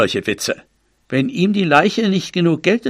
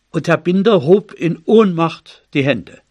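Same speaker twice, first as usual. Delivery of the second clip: ordinary delivery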